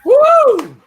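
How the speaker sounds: very high, loud whoop; cheering whoop. Real, not acted. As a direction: cheer her off the stage. Host cheers after the poem.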